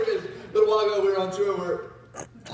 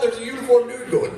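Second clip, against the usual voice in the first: usual speaking voice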